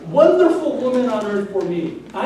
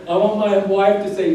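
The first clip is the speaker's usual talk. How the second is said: normal talking voice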